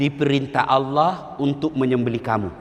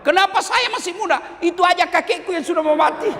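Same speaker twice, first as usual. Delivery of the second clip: put-on much higher, childish whining voice; cheeky modern kid. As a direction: play a smart-mouthed boy talking back to his father. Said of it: "pasti dia jawab". (suara anak zaman sekarang yang membantah, jauh lebih tinggi)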